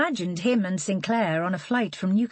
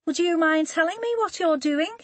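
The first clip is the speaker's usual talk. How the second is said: put-on posh Scouse accent; 11 semitones higher than usual. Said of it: "Holly recognised the accent as posh Scouse".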